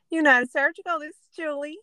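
high, bright receptionist phone greeting; imitating Julie's phone greeting. Supplier rep answering a call from a customer, high phone greeting. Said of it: "she's like".

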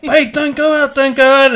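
high-pitched imitation of a worried partner; imitated plea. Man imitating Atafai begging him to stay, high plea.

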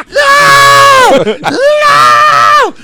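long, very high wail of terror; acted panicked scream. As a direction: scream no while hanging on, hammed-up. Acts out being dragged to dam.

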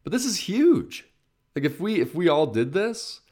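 sudden soaring enthusiasm; excited. Real, not acted. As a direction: throw your excitement at it. Passionate pitch for planting.

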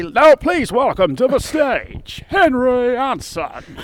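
high, slow, booming stage announcer; voice of God announcer. Shown as a demonstration. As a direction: welcome them like the X Factor voiceover guy. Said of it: "Give us a couple now".

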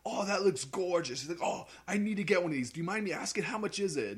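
high-pitched imitation of an Australian waiter; Australian accent. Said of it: "he had, like, an Australian accent".

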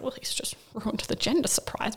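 soft dismayed whisper; whispered thought. Retold or imitated. New mother thinking the midwife spoiled the surprise, soft whisper. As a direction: mutter the thought under your breath.

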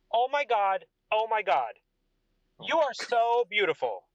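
high, gushing adoration; smitten king. Acted. King admiring Ragna's beauty, smitten. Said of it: "He goes".

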